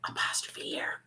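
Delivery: hushed reveal; whisper. Acted. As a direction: whisper it like a secret.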